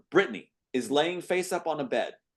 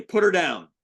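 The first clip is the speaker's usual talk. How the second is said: commanding; 6 semitones higher, 8 dB louder than usual. Acted. Orderly confronts Neo.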